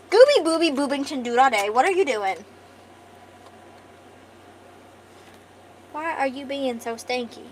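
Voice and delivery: gushing pet voice to a tiny cat; talking to my cat. Acted. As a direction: coo at the cat like it's a baby. Cooing at her cat again.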